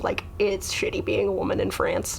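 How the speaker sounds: hushed whisper; whispered gossip. Real, not acted. Comedian whispering gossip about dating in France. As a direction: lean in and whisper it.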